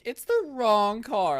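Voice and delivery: high, loud, stretched-out meme cry; mock wail. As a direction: whine it slowly in parody of a tantrum. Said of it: "we all used to go".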